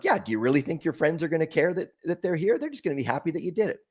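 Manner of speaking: gently chiding, lilting upward; pastor's reassurance. Retold or imitated. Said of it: "the pastor would say it".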